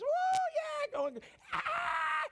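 high falsetto shouts of joy; crowd cheering. Retold or imitated.

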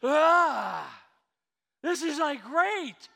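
big joyful exclamation; emphatic exclamation. Shown as a demonstration. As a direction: throw it out like great news. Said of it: "what's called an emphatic tense".

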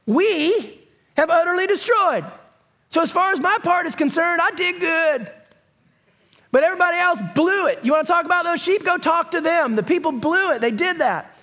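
whiny self-justifying king; defensive Saul. Retold or imitated. Saul's excuses to Samuel.

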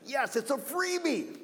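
ordinary talk

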